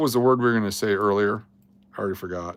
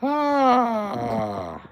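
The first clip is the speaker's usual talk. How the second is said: sudden outcry; 11 semitones higher than usual. Real, not acted. Outburst on stream.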